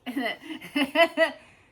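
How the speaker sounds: loud, high burst of laughter; sudden cackling laugh. Real, not acted. Speaker laughing after acting out the squabble, loud laugh. Laughs at own acted argument.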